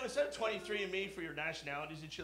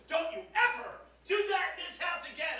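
high, loud outraged wife; furious wife shouting; wife yelling; 12 semitones higher, 4 dB louder than usual. Retold or imitated. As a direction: scream it like a scandalized wife. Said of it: "And she goes".

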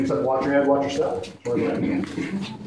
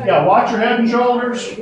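calling out warning; 6 semitones higher, 6 dB louder than usual. Real, not acted. Guide warns group in low passage.

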